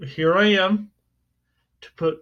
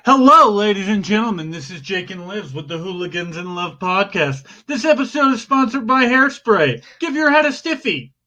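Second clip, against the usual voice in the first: podcast announcer intro; 2 semitones higher, 4 dB louder, faster than usual. Pretend. Host opening the podcast with a silly hairspray sponsor, announcer voice. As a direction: punch the greeting, then lift into a mock ad read.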